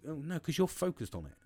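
ordinary talk

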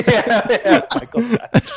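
high laughing yeah; laughing while naming Michael Scott. Real, not acted. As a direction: laugh through the words. Laughing agreement.

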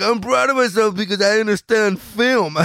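hammed-up smug teenager; mocking younger self. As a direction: puff up like a kid who thinks he gets cinema. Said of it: "And I was like".

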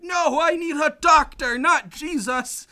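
high, loud, upset protest, slowing on the last words; upset demand. Retold or imitated. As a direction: insist angrily on a doctor. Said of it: "Rom's really upset and is like".

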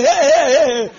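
put-on loud, high, jolly cheer for a fallen child; playful, booming reassurance; laughing it off. Shown as a demonstration. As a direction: make light of a tumble. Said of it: "Just laugh and say".

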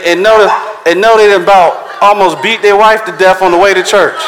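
normal talking voice

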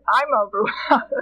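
laughing at her own punchline. Real, not acted. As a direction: say it through laughter. Laughing while telling joke.

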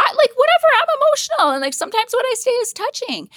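very high, emotional squeal; defensive outburst. Real, not acted. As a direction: defend yourself in a high, worked-up voice. Defensive about crying often.

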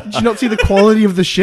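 high, loud, fast landlady nag; shrill nagging landlady. Pretend. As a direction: nag about the ship like a landlady. Landlord voice demanding rent.

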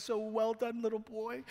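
put-on high, sing-song voice for a little boy; mock patronizing praise. Co-pastor teasing John like a small child, high mock praise. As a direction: pat him on the head with your voice. Pastor mocks John's golf injury.

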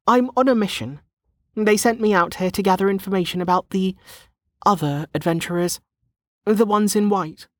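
put-on high-pitched young woman, insistent; explaining young woman. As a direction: justify yourself in a high character voice. Voices Lana explaining.

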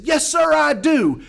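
high, loud, emphatic yes; shouted reply. Retold or imitated. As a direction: shout back your belief. Shouted reply in excited exchange.

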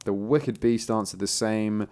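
plain reading voice

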